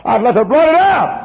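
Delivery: high, loud plea; imitated pleading sinner. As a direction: beg to have it wiped away. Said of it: "You say".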